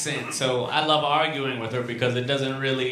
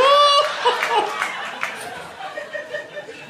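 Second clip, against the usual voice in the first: squealing laugh, much higher; high cackle; 5 dB louder than usual. Real, not acted. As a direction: burst out laughing. Laughing after hype cheer.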